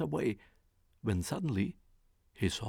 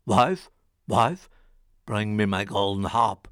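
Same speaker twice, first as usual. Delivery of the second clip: loud, low giant call; ogre calling; 4 semitones lower, 9 dB louder than usual. Acted. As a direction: bellow an order across the house. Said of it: "the ogre called out".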